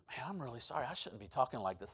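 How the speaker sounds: low, hushed imitation of apologising; sheepish apology. Co-worker apologising to preacher for his talk, sheepish. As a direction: drop soft and low, apologetic.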